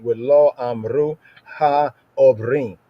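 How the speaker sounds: "Ha, Obrim" drawn out slowly with a throaty ayin on "Obrim"; emphasized ayin. Shown as a demonstration. Teacher stressing the hard sound for students, slow and deliberate. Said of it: "I just emphasize the ayin there".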